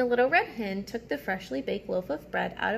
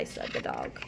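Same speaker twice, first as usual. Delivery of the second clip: sleepy low mumble; 6 dB quieter than usual. Acted. Sleepy dog tag.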